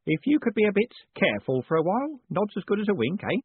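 put-on high-pitched, eager old-hand Grote voice; high, chatty character voice; deferential lodging keeper. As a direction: pass on news to your tenants, pitched well up.

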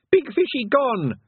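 put-on high, loud little-boy voice; Wentworth. A narrator's reading. Toddler brother speaks.

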